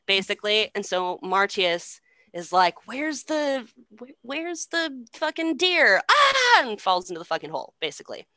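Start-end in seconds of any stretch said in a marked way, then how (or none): 2.9-6.0 high, put-on searching voice; clueless hunter. Hunter looking for the deer by the pit, mock clueless. 6.1-6.6 high, loud mock scream; falling scream. Hunter falling into the pit, hammed-up scream. Retold fall into the hole.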